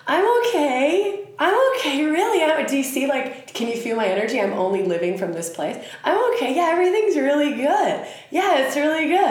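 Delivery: high, bright mock-cheery I'm okay; fake cheerful smile voice. Mimicking someone faking she's fine to Pluto, high and forced. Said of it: "put on a fake Barbie doll smile".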